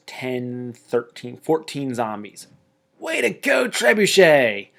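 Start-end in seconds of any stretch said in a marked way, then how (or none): 3.0-4.7 triumphant cheer. Real, not acted. Cheering trebuchet killing zombies.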